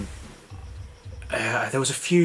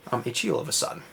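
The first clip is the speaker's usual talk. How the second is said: normal talking voice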